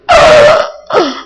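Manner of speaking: sharp high gasp of amazement; astonished gasp. Acted. Preacher acting out a dollar found, gasping. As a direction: gasp as if you just saw money.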